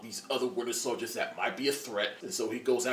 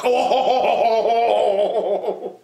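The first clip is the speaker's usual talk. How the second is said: loud, high laughing yelp; giant's startled cry, laughing; 9 semitones higher, 12 dB louder than usual. Retold or imitated. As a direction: yelp out in surprise. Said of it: "Ant-Man's like".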